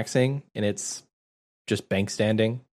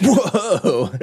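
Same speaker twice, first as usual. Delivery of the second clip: high surprised whoa; surprised exclamation; 8 semitones higher, 5 dB louder than usual. Real, not acted. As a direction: react with sudden delight. Reacting to hat.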